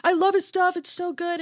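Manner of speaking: high-pitched imitation of an excited fan; gushing excitement. Retelling a co-worker loving the music, high excited gush. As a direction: gush like a thrilled fan, hammed-up.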